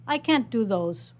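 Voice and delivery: usual speaking voice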